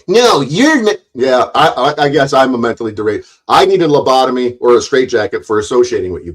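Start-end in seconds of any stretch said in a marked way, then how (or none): 0.1-1.0 higher-pitched mock comeback; lightly mocking protest; imitated retort. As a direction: parrot their objection in a raised pitch. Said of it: "You'll say".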